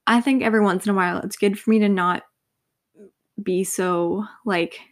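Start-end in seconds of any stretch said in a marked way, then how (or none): none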